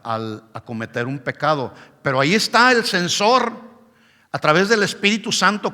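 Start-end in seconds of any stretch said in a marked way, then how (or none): none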